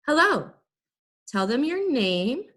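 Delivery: ordinary talk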